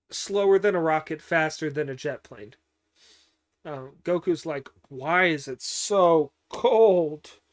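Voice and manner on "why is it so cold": loud, drawn-out mock whine; slow, emphatic retold complaint. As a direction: stretch each word out in exasperation, loudly. Retold Goku complaining of the cold.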